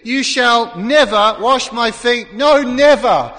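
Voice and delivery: slow, loud, stressed refusal with NEVER hammered; emphatic refusal. Retold or imitated. Peter refusing Jesus washing his feet, loud emphatic refusal. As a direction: Peter flatly refusing, each word weighed. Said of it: "It was emphatic".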